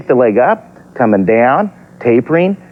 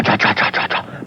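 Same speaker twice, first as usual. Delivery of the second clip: softer and softer whispered chant; fading whisper; 4 semitones lower, 7 dB quieter than usual. Shown as a demonstration. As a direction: let it die away to a whisper.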